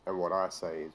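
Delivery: ordinary delivery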